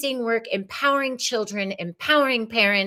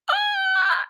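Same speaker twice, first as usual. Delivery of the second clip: tiny, very high squeal; excited squeal. Pretend. Host thrilled by karaoke idea, high squeal. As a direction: let out a short high squeak of glee.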